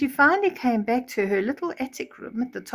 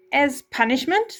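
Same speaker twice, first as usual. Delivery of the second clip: higher, louder stepmother scolding; stern stepmother; 6 semitones higher, 4 dB louder than usual. Acted. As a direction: lay down the punishment. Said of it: "the stepmother said to Cinderella".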